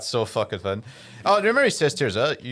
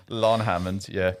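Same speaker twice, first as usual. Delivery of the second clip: usual speaking voice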